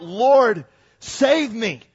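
a loud, pleading shout for help; desperate cry. Acted. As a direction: cry out as if sinking. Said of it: "he cried out".

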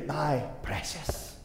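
slow, soft, hissing Gollum voice; a hint of Gollum, slow and soft. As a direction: whisper it like Gollum clutching the ring. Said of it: "to use the words of J R R Tolkien".

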